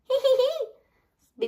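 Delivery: high, slow, spelt-out giggle. A narrator's reading. Peppa painting with her hands, high acted giggle. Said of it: "Peppa giggles".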